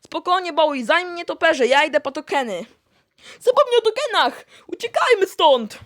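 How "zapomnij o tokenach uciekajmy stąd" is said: high, loud urgent cry to run; panicked shout. Acted. Camper yelling to forget the tokens and run. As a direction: shout it while fleeing. Fleeing from the bats.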